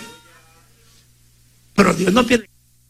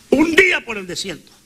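ordinary talk

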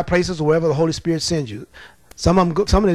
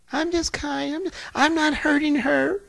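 higher, softer, slow mock-innocent voice; demure demon voice; 9 semitones higher, 4 dB quieter, slower than usual. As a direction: play the sweet liar, higher and softer. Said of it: "called himself being nice and demure".

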